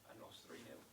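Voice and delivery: straining through a failing voice; real hoarse, nearly voiceless; losing voice. Real, not acted. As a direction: voice giving out, half whispered. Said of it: "losing my voice".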